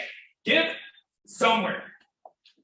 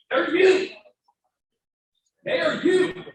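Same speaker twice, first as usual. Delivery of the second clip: fervent; 9 semitones higher, 3 dB louder, slower than usual. Real, not acted. Urging donors to identify.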